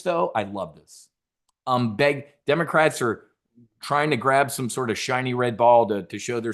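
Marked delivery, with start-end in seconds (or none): 0.3-1.1 softer, lower I love this. Real, not acted.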